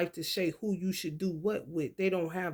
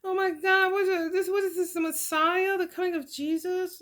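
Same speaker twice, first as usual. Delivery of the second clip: mock astonishment; 11 semitones higher than usual. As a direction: squeal it like you can't believe it. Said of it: "she was just like".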